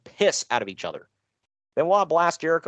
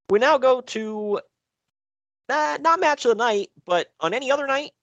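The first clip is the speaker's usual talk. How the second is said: match announcer build-up; 8 semitones higher than usual. Acted. Host introducing the title match, announcer build-up. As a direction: announce the bout with pitch held high.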